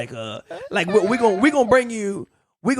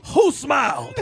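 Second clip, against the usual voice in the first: mock stern wife; 6 semitones higher, slower than usual.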